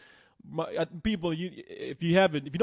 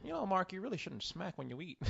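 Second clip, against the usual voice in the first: very soft, prim correction; polite scolding; 13 dB quieter than usual. Retold or imitated. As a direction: gently correct like Mister Proper. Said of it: "I was like".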